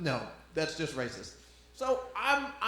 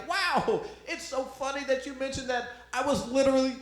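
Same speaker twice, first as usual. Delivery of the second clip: higher mock-cheery retort; sarcastic comeback; 9 semitones higher, 4 dB louder than usual. Comic retells his sarcastic comeback to the heckler, higher and mock-cheery. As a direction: toss it back with fake cheer, higher. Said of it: "I was just like".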